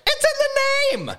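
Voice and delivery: very high, stretched-out squeal; exclaimed realization. Retold or imitated. Telling co-host the obvious minutes later, high exasperated cry. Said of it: "I looked at you and I'm like".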